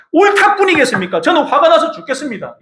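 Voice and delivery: high-pitched indignant retort; angry Jonah. Acted. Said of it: "요나가 대답하였다".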